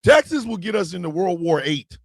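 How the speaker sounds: usual speaking voice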